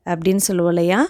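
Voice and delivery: usual speaking voice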